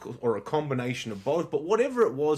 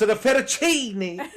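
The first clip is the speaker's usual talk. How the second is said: fettuccine in a hammed-up Italian accent, higher and laughing; mock Italian accent; 7 semitones higher, 5 dB louder than usual. Mocking his ex ordering pasta, over-the-top Italian accent. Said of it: "pronounce everything on the menu in an Italian accent".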